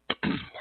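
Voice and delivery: clears throat from allergies; quick throat clear mid-talk. Real, not acted. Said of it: "I have some allergies going on here".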